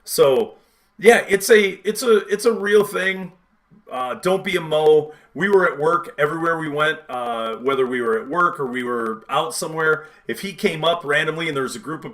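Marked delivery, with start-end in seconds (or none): none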